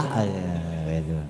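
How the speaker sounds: ordinary talk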